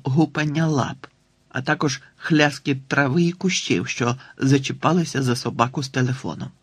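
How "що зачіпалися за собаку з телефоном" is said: plain reading voice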